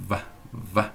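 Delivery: soft low vá, vá with lips buzzing; voiced v demo. Shown as a demonstration. Teacher demonstrates the v sound to students. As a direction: lip on teeth, hum the v in vá. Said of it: "lábio inferior".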